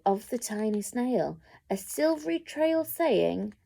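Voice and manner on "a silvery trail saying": ordinary delivery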